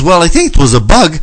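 lightly voiced calm dad. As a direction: answer like a calm father. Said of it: "And he goes".

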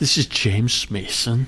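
slow, low, soft English impression; slow right down into a hushed English film-star voice; James Mason. Host tries out an impression on co-host, slow low English voice. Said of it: "How was that?".

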